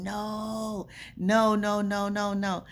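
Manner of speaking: imitating a coworker's horrified outcry; retold scream. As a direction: cry out repeated refusals in alarm. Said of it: "She's screaming".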